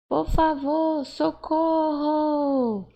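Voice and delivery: high, long-drawn call for help; continued plea for help. Shown as a demonstration. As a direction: demo plea, last vowel held and trailing. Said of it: "a pessoa continuou pedindo socorro".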